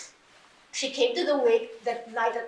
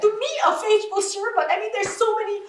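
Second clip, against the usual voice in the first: higher and louder, railing at God; heated retold outburst, nearly crying; retold fury; 7 semitones higher, 4 dB louder than usual. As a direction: rage at God through a cracking half-laugh. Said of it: "So I was that angry".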